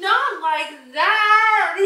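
slow, high laughing cry; laughing protest. Real, not acted. Nail polish removal mishap.

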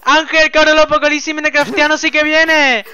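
high, loud frantic shout; alarmed, high-pitched hollering; panicked warning. Pretend. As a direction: yell the warning as disaster closes in. Warns neighbor of creeper apocalypse.

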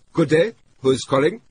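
high, loud, brisk German clerk voice; a clipped underling answering the phone; brisk accented functionary. A narrator's reading. German consulate clerk answers briskly. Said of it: "Accented, brisk male functionary voice".

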